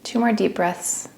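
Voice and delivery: unhurried meditation voice with long pauses; guided meditation. Acted. Teacher talks listeners into relaxing each body part, guided meditation. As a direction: ease them gently through a body scan, leaving space between phrases.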